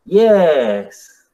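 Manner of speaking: YES drawn out and loud, as a tone demo; rising tone. Shown as a demonstration. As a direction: stretch YES in a demonstrative pitch glide. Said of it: "con el rising tone sería". (YES con tono marcado, alargado)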